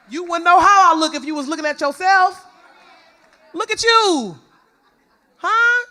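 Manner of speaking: loud, high mock comeback; indignant retort. Speaker answers those who call her mean, loud indignant retort. Snaps back at people who stared.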